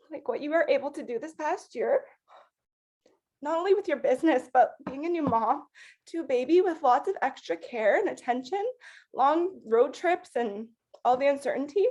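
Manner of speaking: fighting tears while praising a teammate; holding back tears. Real, not acted. Manager praising her teammate Ashley, voice breaking with tears. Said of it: "Sorry, I'm trying not to cry".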